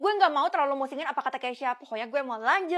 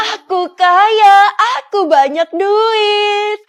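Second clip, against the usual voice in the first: loud, high, hugely drawn-out cheer; overjoyed, slow singsong shout; ecstatic gloating; 6 semitones higher, 10 dB louder, much slower than usual. Acted. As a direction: crow it out, stretching every word. Rejoices at sudden riches.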